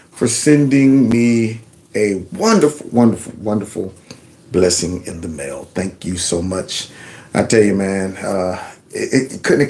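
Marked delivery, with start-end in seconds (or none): 2.9-6.9 soft grateful thanks. Real, not acted. Thanking viewer softly.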